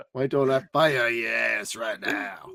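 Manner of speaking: mock shout of the chief's line; lightly hammed chief impression; quoting the chief. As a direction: fire off the boss's threat. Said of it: "That's gonna be a quote from now on".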